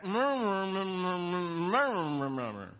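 closed-mouth hums, lips sealed around something; mumbling with mouth full. Retold or imitated. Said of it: "the old man goes".

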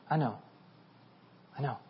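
very soft, understanding murmur; gentle consoling. Acted. Jesus answers a follower who missed it, softly consoling. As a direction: console softly, leaving space between phrases.

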